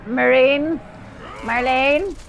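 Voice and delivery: high calling voice; calling out, confused. Retold or imitated. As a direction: call out a name, unsure. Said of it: "and she's like".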